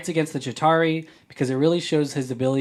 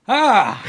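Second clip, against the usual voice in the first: startled yelp; 9 semitones higher, 7 dB louder than usual. Real, not acted. Blindfolded arrow stunt.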